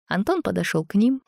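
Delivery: ordinary delivery, nothing marked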